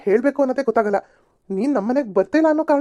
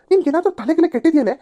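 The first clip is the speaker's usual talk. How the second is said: exasperated scolding; 7 semitones higher, 3 dB louder than usual. Acted.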